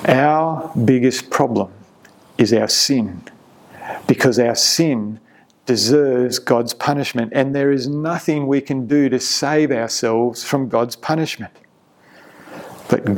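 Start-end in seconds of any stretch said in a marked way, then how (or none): none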